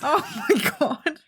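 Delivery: high helpless laughter; laughing hard. Real, not acted. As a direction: laugh till you cry.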